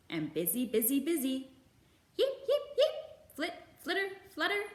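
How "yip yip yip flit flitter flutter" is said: very high, loud chick yips; high chirpy chick cries; baby bird peeps. A narrator's reading. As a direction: squeak like busy hatchlings. Busy chicks being watched.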